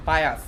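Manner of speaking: বায়াস ending in a clear s; dental s demo. Shown as a demonstration. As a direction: show the plain s at the end of বায়াস. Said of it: "এরকম".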